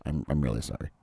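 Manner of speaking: low, soft mock apology from a gentle nurse. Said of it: "He was like".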